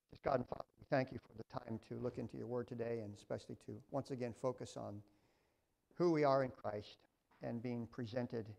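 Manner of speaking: soft, hushed prayer voice; quiet prayer. Real, not acted. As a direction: pray quietly to God before the lesson. Pastor leads opening prayer.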